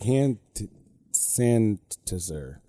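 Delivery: "sanitizer" slow and broken, syllables squashed; imitating child mispronouncing. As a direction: stumble slowly through "sanitizer" like a small child. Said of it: "trying to say hand sanitizer".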